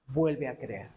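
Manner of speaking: muy grave y calmado; invitación serena. Acted. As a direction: invita con calma, dejando caer la voz. Invitación de Dios, primera toma. (invitación grave y serena)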